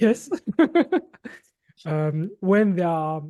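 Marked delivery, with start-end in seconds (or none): 0.3-1.0 short high amused laugh. Real, not acted. Amused after finding word.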